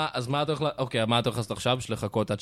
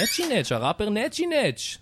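much higher, drawn-out hype of the rapper's name; hype announcer intro; 11 semitones higher, slower than usual. Acted. Host introducing the rapper guest, hyped and drawn out. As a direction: announce the star like a show host.

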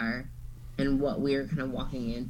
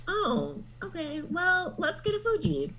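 high mock voice; 12 semitones higher, 4 dB quieter than usual. Mocking the couple's reaction.

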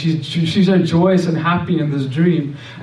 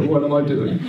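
laughing; 3 dB quieter than usual. Real, not acted. Laughing at his own nerve.

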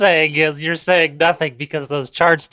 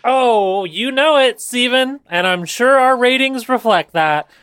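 hammy commercial voice; 8 semitones higher, 3 dB louder, slower than usual. Acted. Plays along in ad voice.

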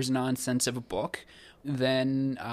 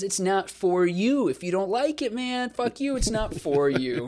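higher-pitched, brush-off imitation of the author; mock dismissive; 6 semitones higher than usual. Podcaster mimics author shutting out readers, mock dismissive.

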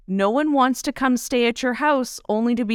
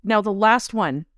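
ordinary talk

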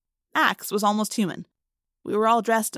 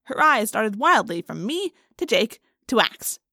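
ordinary delivery, nothing marked

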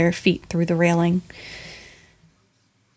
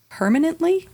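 sharply rising, incredulous; surprised question; 10 semitones higher than usual. Acted. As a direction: ask it in disbelief. Character asks in surprise.

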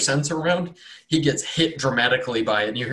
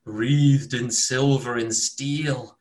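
slow, feverish doom prophecy; haunted by visions of death; raving prophet; 3 semitones higher, slower than usual. Acted. Mad cultist prophesying dead dwarves to the cleric.

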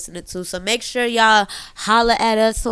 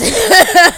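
loud laugh; 9 semitones higher, 8 dB louder than usual. Real, not acted. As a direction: burst of laughter. Laughs at own joke.

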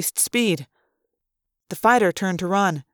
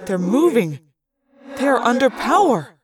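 astonished realization; 4 semitones higher than usual. A narrator's reading. Pilot realizes asteroids are powered.

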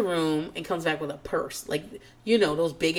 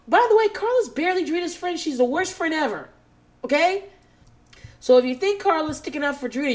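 heated rant; 9 semitones higher, 3 dB louder than usual. Real, not acted. Ranting at Renee.

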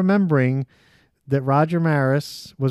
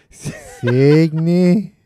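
louder drawn-out Cygni in a funny voice; playful put-on voice, louder; put-on lady voice; 6 dB louder than usual. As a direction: call out the word in a character voice. Mimicking bodega lady voice.

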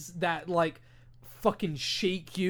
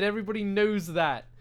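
ordinary talk

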